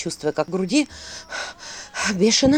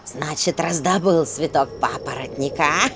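low, mocking bird voice; smirking raven; 5 semitones lower than usual. A narrator's reading. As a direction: sneer it like a sly raven. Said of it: "усмехнулся ворон".

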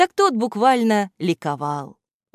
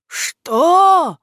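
loud, high, rising roar; outraged roar; 12 semitones higher, 8 dB louder than usual. A narrator's reading. Boss reacting to henchman's doubt, outraged roar. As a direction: roar it in disbelief. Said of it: "взревел Кляксич".

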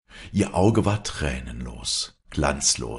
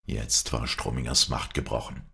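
plain reading voice

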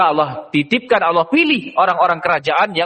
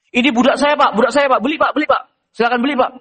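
higher, pushy sales pitch; brothers selling; 7 semitones higher, faster than usual. Acted. Brothers urge the trader to buy, pushy and higher. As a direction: push the sale on the trader.

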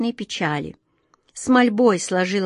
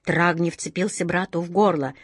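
ordinary delivery

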